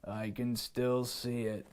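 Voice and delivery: put-on the lion spots the hiding boy, low. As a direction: tell him low he's still visible. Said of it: "said the lion".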